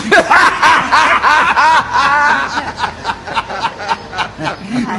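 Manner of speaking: a long high real laugh. Real, not acted. Storyteller laughing long at his own tale. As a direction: laugh helplessly.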